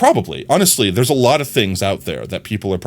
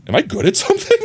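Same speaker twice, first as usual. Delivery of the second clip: surprised disbelief; faster than usual. Pretend. Imagined person realizing talent.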